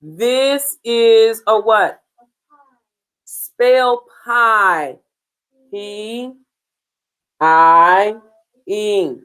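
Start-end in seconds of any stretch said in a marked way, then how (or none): none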